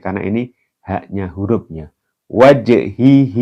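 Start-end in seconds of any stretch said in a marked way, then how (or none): 2.3-3.4 "Wajhihi" slow with the j bounced; bounced jim. Shown as a demonstration. Teacher insisting the jim must bounce, slow recitation. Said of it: "harus dipantulkan".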